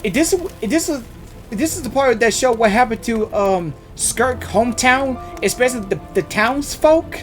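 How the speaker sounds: normal talking voice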